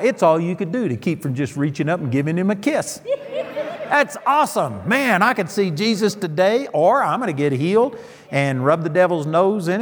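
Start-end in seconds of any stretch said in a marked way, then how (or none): 3.0-3.8 laugh. Real, not acted. Laughs at his own joke.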